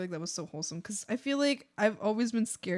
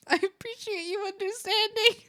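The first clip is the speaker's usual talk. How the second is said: high, voice almost crying with laughter; touched, teary thanks; 12 semitones higher, 4 dB louder than usual. Retold or imitated. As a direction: thank them as if moved to tears. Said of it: "I was like".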